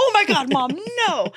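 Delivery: high, loud mock wail; imitated bawling. Daughter denying her mom's guess, hammed-up bawling. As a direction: wail like a mortified teenager. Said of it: "So I'm bawling my eyes out".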